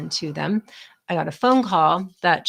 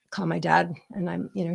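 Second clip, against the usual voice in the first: ordinary delivery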